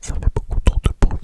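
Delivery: hushed whisper so it's not noisy; library whisper. Real, not acted. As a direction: whisper very low into the mic. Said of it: "il faut que je parle tout bas".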